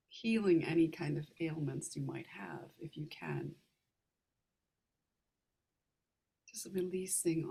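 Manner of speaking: calm meditation guide, unhurried, with long pauses; guided meditation. Real, not acted. Meditation leader guiding listeners to relax the heart, calm guided meditation. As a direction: ease listeners gently through each step, leaving room to breathe.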